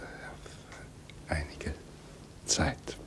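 whispered very slowly, drawing out einige; slow, bitter whisper. Acted. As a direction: let "Auf einige Zeit" crawl out in a whisper. Repeating the phrase, dragged out.

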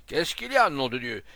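high, loud oath-laden question; angry demand. A narrator's reading. Brigadier to the crowd, exasperated shout. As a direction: shout for an answer.

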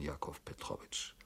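Jakow Petrowitsch breathed unvoiced; bewildered whisper of the name.

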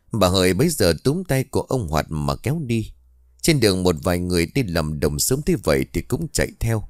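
plain reading voice

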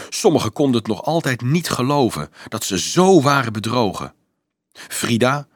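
ordinary delivery

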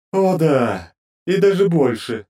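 high, sneering goddess mimicry; mock his words back at him. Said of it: "словно передразнила самого Руорна".

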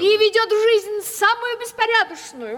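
indignant outburst. Acted. Widow complaining to guests about her nephew, indignant. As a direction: throw up your hands in outrage.

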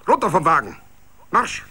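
high, loud barked command; sergeant's bark. A narrator's reading. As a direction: order him like a drill sergeant. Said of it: "Feldwebel-Barschheit".

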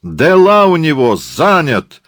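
high, loud yell; shouted report. Acted. Abdulla relays the excuse to Murat, yelling. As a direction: holler it across to your boss. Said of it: "кричит Мурату Абдулла".

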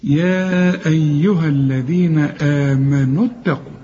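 slow recitation with the waw of اتقوا dropped; tajweed demo. Shown as a demonstration. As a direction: read يا أيها الذين آمنوا اتقوا slowly, linking without the waw. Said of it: "إذا وصلت الواو برضو بلغيها".

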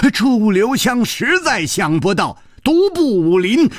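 ordinary delivery

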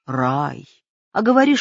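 ordinary delivery, nothing marked

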